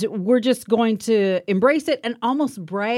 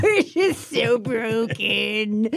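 mock self-pity; slower than usual. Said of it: "You're mocking what you are".

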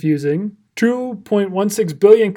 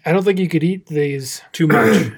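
ordinary talk

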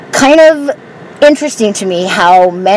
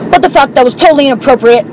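higher-pitched mock outrage; mild mock yell, pitched up; retold yelling; 4 semitones higher, faster than usual. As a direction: imitate an angry man's complaint, not at full volume. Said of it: "he's yelling at me".

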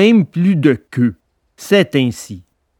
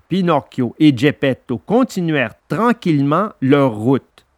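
plain reading voice

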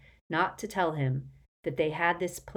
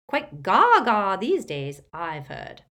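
breezy, slow cheerful dismissal; cheerfully; 2 semitones higher, 8 dB louder, slower than usual. Acted. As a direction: toss it off gleefully. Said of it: "said Auntie Muriel cheerfully".

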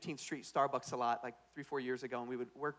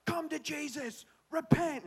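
high, drawn-out mock street-preacher cry; hammed-up high repent call; street-corner preacher lady; 13 semitones higher, 3 dB louder, much slower than usual. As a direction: imitate a heckled sidewalk evangelist calling out. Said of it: "this lady on the corner who's like".

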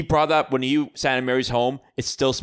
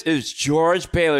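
teasing announcement; 6 semitones higher, 6 dB louder, slower than usual. Retold or imitated. Mary announcing George teasingly.

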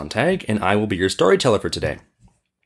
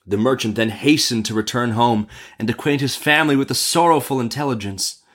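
usual speaking voice

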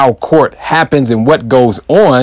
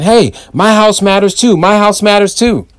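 complaining neighbours; 8 semitones higher than usual. Retold or imitated. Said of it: "everybody's on their porch saying".

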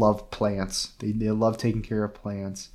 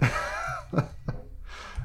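soft, voiceless chuckle; quiet breathy laughter; amused laugh; 8 dB quieter than usual. Real, not acted. Amused at describing odd alien.

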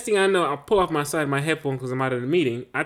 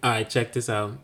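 usual speaking voice

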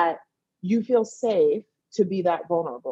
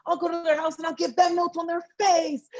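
mock outraged friend; 12 semitones higher than usual. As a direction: play the furious best friend, over the top and laughing. Friends outraged on her behalf.

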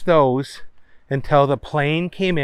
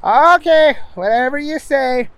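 a bit higher character voice; Booger voice; 10 semitones higher, 4 dB louder than usual. Acted. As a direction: raise the voice for Booger.

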